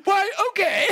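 high, playful British imitation; mock British accent. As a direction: answer back in a put-on British accent. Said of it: "I said it back in, like, with an accent".

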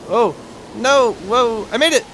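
loud, high exclamations; excited relief. Real, not acted. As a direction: cry out as you just make it. Narrow success in game.

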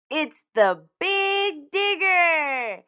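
high, loud, very drawn-out announcement; slow, booming excited child's cry; triumphant shout. A narrator's reading. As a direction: stretch it out like a grand discovery. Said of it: "shouted Usha".

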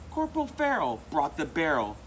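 ordinary delivery, nothing marked

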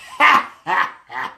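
loud imitated guffaw trailing off; acted laughing fit. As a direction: re-enact laughing until choking. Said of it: "I was so laughing. I was like".

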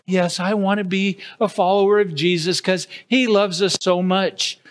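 normal talking voice

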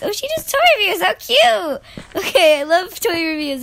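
high, loud delighted squeal; excited gushing. Real, not acted. Gamer delighted by friend's toy reviews, excited gushing. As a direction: gush at full volume.

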